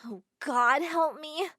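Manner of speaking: slow, drawn-out plea to God; despairing groan. Acted. Narrator groaning to herself in slow despair. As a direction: moan it out in despair. Narrator's dread opening line.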